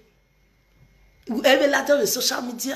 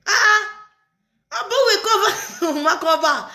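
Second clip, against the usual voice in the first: laughing; 6 semitones higher than usual. Real, not acted. Laughing at covering for her.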